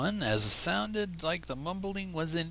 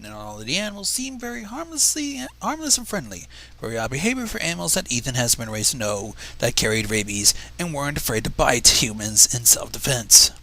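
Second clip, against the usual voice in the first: ordinary delivery, nothing marked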